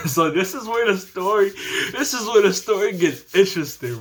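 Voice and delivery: chuckling through the teaser; can't hold back a laugh at what's coming. Real, not acted. Laughing build-up to twist.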